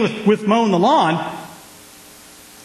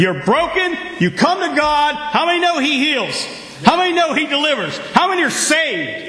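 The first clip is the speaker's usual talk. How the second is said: rousing preaching; 7 semitones higher than usual. Acted. Preaching rising to call.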